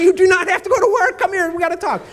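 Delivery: high, loud exasperated dad, retold; emphatic insistence. Dad interrupts sulking daughter in kitchen, emphatic.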